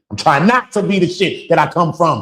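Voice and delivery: impassioned outburst. Real, not acted. Emotional confession.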